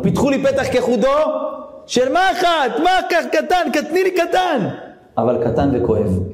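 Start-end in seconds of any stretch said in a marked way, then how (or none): 0.0-4.7 voicing the verse much higher and thinner; quoting God. Preacher quoting God's request to the congregation, high and thin. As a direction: squeeze the words up high, small as a needle's eye. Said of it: "מה השם אומר לנו?".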